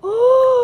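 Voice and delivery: loud, high gasp; big intake of surprise; amazed gasp. Real, not acted. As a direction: gasp sharply in delight. Pops out the frozen treat.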